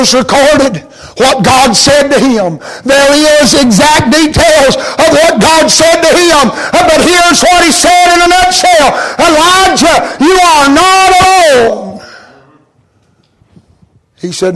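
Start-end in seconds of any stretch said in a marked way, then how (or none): 2.8-12.0 higher, louder, slowing into God's promise; full-force preaching. Acted. Preacher proclaiming God's words to Elijah, ringing and slow. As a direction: proclaim it like a revelation.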